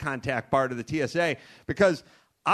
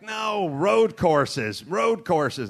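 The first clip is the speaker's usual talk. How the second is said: slow, stressed "road courses", louder; insistent correction; 4 semitones higher, 4 dB louder, much slower than usual. Retold or imitated. Speaker insisting on road courses to Americans.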